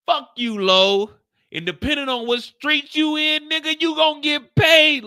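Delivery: cursing him off. Retold or imitated. Lou cursing Lo out.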